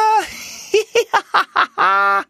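high mocking goose cackle; high goose laugh. Wild geese laughing at the tame geese, high cackle. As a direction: cackle at them.

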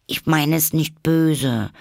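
put-on high, slow childlike plea; apologetic. A narrator's reading. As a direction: protest innocently, asking forgiveness. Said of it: "entschuldigte sich die arme Alice".

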